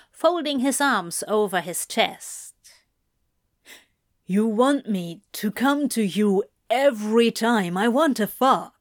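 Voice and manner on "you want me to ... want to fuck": mildly incredulous demon; somewhat incredulous. A narrator's reading. Demon questioning the angel's proposal, somewhat incredulous. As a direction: question it with a touch of disbelief. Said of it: "he says, somewhat incredulous".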